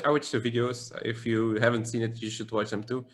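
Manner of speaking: usual speaking voice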